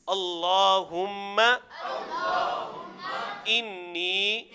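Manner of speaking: very slow, drawn-out Arabic supplication; measured teaching chant, phrase by phrase; repeat-after-me recitation. Shown as a demonstration. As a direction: stretch each phrase for students to repeat. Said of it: "میرے ساتھ پڑھیں گے".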